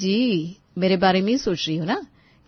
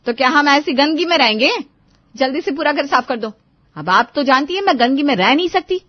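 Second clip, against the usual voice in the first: put-on higher-pitched, bossy young bahu voice; higher, insistent daughter-in-law; demanding daughter-in-law; 8 semitones higher, 7 dB louder than usual. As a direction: order the mother-in-law about in a higher voice.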